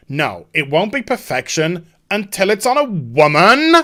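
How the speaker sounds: hammed-up declaration rising at the end; mock self-righteous heroine. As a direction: crow it with a swooping finish. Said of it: "she's just like".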